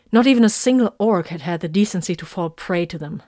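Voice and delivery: plain reading voice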